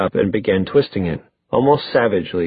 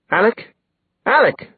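high alarmed call, climbing; touch of panic, voice rising; 11 semitones higher than usual. A narrator's reading. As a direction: call the name twice, pitch leaping higher. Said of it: "Her voice rose, tinged with panic".

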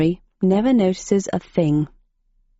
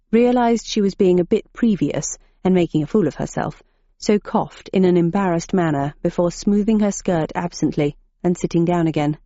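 ordinary delivery, nothing marked

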